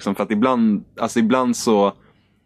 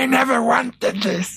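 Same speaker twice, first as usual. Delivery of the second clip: mock scream; slower than usual. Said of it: "hon typ bara skrek".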